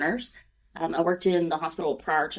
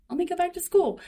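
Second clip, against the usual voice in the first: retold self quote; 13 semitones higher than usual. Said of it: "I said".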